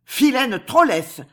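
put-on slow, high, loud accented insult; Nucingen's outraged cry. A narrator's reading. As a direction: exclaim indignantly in a thick Germanic accent. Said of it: "s'écria Nucingen". (cri indigné, lent, à l'accent germanique)

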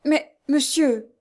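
slow, high cry of protest; desperate protest. A narrator's reading. Jeanne objects to the stranger, desperate. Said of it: "s'écria Jeanne avec l'énergie du désespoir".

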